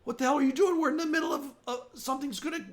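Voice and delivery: high, loud indignant outburst; outraged villager. Acted. Villager rounding on the armed stranger, high and outraged. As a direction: raise the voice high in disbelief.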